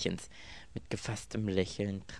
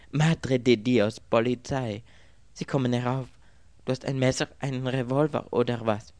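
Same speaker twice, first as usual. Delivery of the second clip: higher, loud, urgent alarm; 4 semitones higher, 8 dB louder than usual. A narrator's reading. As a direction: raise pitch and volume in acted panic. Mädchen meldet entsetzt die Polizei. (aufgeregt und alarmiert)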